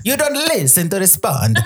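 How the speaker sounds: put-on playful Italian accent with a laugh; light Italian accent, laughing.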